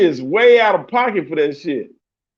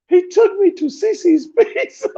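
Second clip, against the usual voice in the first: higher, put-on voice of an offended woman; girlfriend's indignant complaint; 11 semitones higher than usual. Imitating the girl complaining to the narrator, higher put-on voice. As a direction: mimic her pouty complaint about the date. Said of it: "she folds her arms like this".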